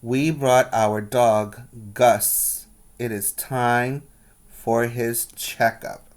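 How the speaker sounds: put-on lively read-aloud for children; storybook reading voice; story narration. A narrator's reading. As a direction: tell the story brightly to the class. Teacher reads picture book aloud.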